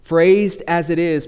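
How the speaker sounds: ordinary talk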